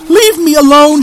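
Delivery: high, loud desperate scream. Acted. Girl screaming at the haunting voice, loud scream. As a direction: scream it in panic. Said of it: "she screamed again".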